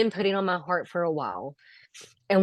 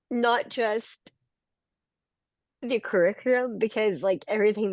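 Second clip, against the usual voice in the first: higher voice straining through emotion, long pauses; choked up; 3 semitones higher than usual. Real, not acted. Graduate telling the group what they mean to her, choked up. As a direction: push each phrase out while holding back tears. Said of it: "try to get through this without getting emotional".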